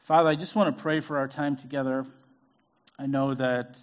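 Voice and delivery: ordinary delivery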